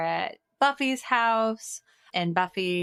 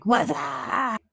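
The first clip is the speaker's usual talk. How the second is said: high, louder drawn-out "What's up?" like the movie; catchphrase impression; 6 semitones higher, 4 dB louder than usual. Three friends on a call, all hollering the greeting. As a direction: ham up the movie "What's up?" with a stretched "up". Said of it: "I think you nailed it".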